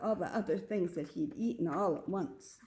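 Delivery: plain reading voice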